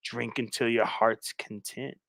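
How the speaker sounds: low, slow, soft invitation; creepy stalker. Acted. Captor urging his guest to drink, low and creepy. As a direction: invite slowly, low and hushed. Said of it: "He's like".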